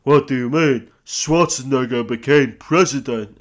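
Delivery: loud, hammed-up action-star impression; Sly. Host as the baffled actor, loud mock impression. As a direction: blurt it in a bad film-star voice. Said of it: "Also, stop that voice".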